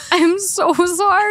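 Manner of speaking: high, slow, drawn-out sorry; sheepish apology. Retold or imitated. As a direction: plead sorry in a small high voice, slowly. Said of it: "like, go".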